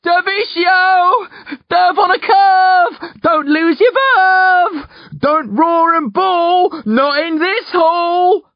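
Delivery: high, slow, drawn-out yelling; shouted rhymes. A narrator's reading. Boy rousing a man from a nightmare, shouting. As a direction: yell each rhyme hard to break through.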